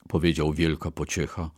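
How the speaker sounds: usual speaking voice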